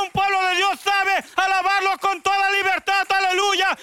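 high, loud fervent preaching with aleluyas; predicación a todo pulmón; full-force preaching. Acted.

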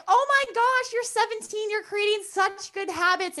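high-pitched imitation of gushing fans; mock everyone fawning in a high voice; over-the-top retold praise; gushing admiration.

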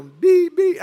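loud high imitated honking; mock beep beep. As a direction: blare it like a car horn. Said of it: "And he's like".

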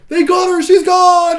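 high, loud panicked yell; frantic shout. Retold or imitated. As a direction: cry out that she's been taken. Avatar yelling in battle recap.

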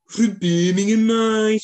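exaggerated Birmingham accent; Brummie accent. Shown as a demonstration. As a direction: put on a thick Brummie. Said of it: "You've got Brummie".

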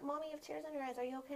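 lightly voiced little kid, high and soft; a hint of a worried child asking; a touch of small child's concern. Child voice quoted by mother.

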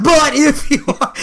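high, drawn-out insisting; mock pushy insistence. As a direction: cut in on his denial, slow and high. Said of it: "she get really pushing".

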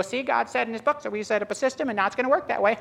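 high mock voice of a formula-follower; mock smugness. Preacher mocking believers who pull levers, high mock smugness. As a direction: parody someone pleased with their system.

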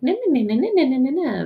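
high sing-song na na na; mock chatter. Mocking friends chattering about distractions. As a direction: babble it like empty gossip.

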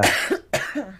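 loud throaty cough; coughing fit. Real, not acted. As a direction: cough hard, then clear the throat. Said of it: "Sorry, I'm coughing so much".

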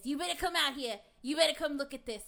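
imitated loud, urgent call; yelling. Husband calls his wife outside to look, yelling. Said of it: "he yells at me".

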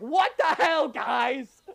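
loud, high indignant yell; hammed-up disbelief; mock outrage. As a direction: act shocked they aren't sad. [name] outraged at calm goodbye.

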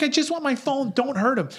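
high whiny plea; mock pleading girl. Girlfriend begs for her phone and for him, high whiny plea. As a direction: whine it like the frantic girlfriend.

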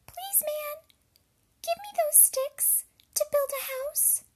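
very high-pitched, squeaky pig voice; little pig. A narrator's reading. Pig begs a man for straw, very high squeaky voice. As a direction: squeak it out as a tiny piglet. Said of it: "The second pig".

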